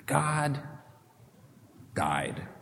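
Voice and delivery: whisper-soft, falling to nothing; hushed and grave; hushed drop. Real, not acted. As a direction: let "died" sink almost silent. Dramatic fall after climax.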